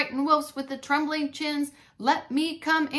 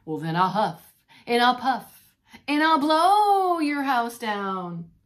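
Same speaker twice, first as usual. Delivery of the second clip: loud threat; 8 dB louder, slower than usual. Acted. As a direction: bellow it bigger and bigger. Pig threatens to blow house.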